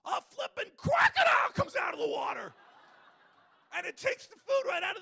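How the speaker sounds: loud, high, laughing shout; excited. Real, not acted. Speaker reveals the crocodile to the audience, loud high laughing shout. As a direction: burst out at the punchline. Climax of the story.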